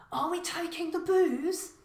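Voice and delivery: put-on 1 excited young son. Son eyes champagne bottles.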